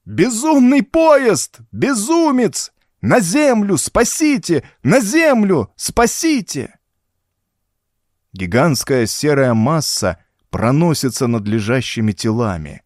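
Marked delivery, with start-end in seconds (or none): 0.0-6.8 high, loud terrified cries; panicked crowd scream. A narrator's reading. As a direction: shriek in sheer panic. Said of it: "вырывается жуткий тысячеголосый крик".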